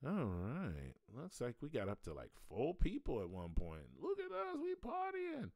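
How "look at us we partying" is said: high party voice. Pretend. Celebrating a few viewers.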